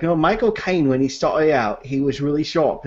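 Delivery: imitated Cockney film-star voice; Michael Caine. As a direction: do a sharp London-accented impression. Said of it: "they do Michael Caine through the years".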